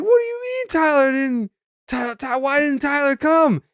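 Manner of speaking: hammed-up tearful, high-pitched plea; [name]. Player asking why Tyler skipped it, high mock-tearful plea. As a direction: stammer in high mock distress. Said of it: "broke down in tears".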